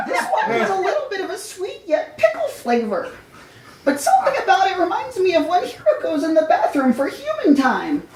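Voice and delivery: high squeaky hamster musing with a laugh; squeaky hamster voice thinking aloud; musing Hamtaro voice. Acted. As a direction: muse like a cute cartoon hamster. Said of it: "Hamtaro muses".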